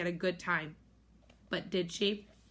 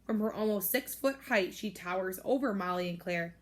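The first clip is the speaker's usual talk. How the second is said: usual speaking voice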